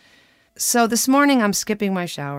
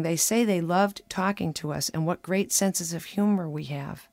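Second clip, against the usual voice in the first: usual speaking voice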